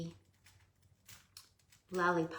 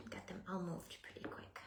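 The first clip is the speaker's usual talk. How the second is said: whispering; 10 dB quieter than usual. Real, not acted. Repeated whispered.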